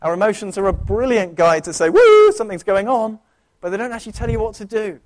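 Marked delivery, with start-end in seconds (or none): 1.9-3.2 startled exclamation. Retold or imitated. Emotions voiced as alarm.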